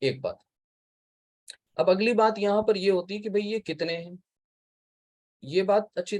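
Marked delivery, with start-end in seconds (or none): none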